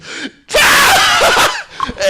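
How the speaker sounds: a huge high cackle bursting out; loud shrieking laugh. Retold or imitated. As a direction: imitate her loudest, shrieking laugh. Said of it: "And she goes".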